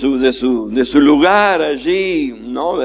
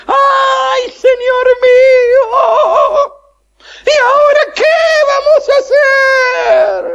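lloriqueo agudo y alto, lento; como llorando; 4 dB louder, slower than usual. Acted. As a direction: gimotea con voz quebrada de pánico. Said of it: "¿Nunca habla como llorando?". (hablando como llorando, muy agudo)

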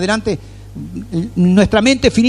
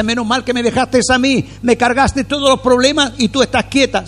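higher, lightly voiced Lord, pleased; the Lord speaking; 7 semitones higher than usual. The Lord answering the believer who rested, lightly voiced and pleased. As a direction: play the Lord glad you handed him the load. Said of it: "Y el Señor dice".

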